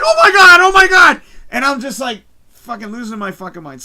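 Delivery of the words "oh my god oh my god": high, very loud excited screams; screaming. Retold or imitated. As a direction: scream it twice in total amazement. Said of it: "I started screaming".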